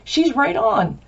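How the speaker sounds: high, slow gasp of discovery; mock amazed, high and drawn out; suddenly seeing it, high and slow; amazed realization. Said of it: "you're going".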